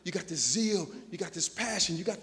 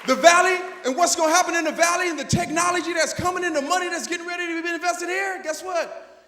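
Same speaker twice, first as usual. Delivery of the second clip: fired-up preaching; 9 semitones higher, 10 dB louder than usual. Acted. Fired-up challenge to educators.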